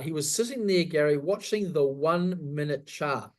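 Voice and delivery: ordinary talk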